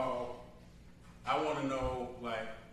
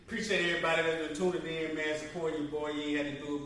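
ordinary delivery

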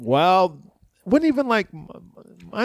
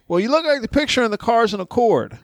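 higher-pitched protesting voice; imitated insistent passenger; 7 semitones higher than usual. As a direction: mimic a confused customer arguing back.

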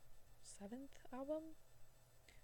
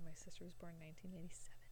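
hushed; 4 semitones lower, 12 dB quieter, faster than usual. Real, not acted. Hushed wrong year.

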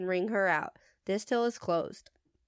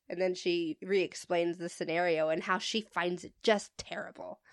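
ordinary delivery

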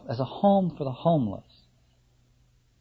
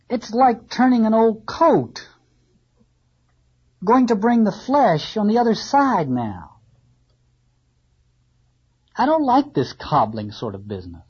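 fussy carpenter; 2 semitones higher, 3 dB louder than usual. Acted. Carpenter complaining about the cobbling job, sniffy. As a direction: grumble like a proud carpenter.

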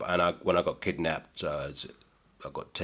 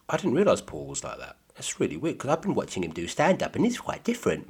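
puzzled fan voice; 8 semitones higher than usual. Retold or imitated. Audience member voiced.

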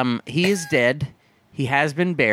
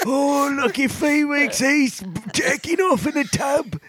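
high mock onlooker; 7 semitones higher than usual. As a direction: gush over Felix in a shrill put-on voice. Voicing an onlooker.

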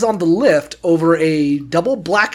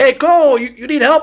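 higher, raised helper's call; 7 semitones higher, 4 dB louder than usual. Retold or imitated. Ski patrol calling to the boss's grandkid, raised holler. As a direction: holler an offer of help from afar.

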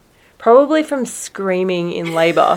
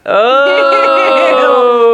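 loud, drawn-out disgusted "oh"; grossed-out groan; 5 semitones higher, 6 dB louder than usual. Real, not acted. Reacting to friends' gross slang, loudly disgusted.

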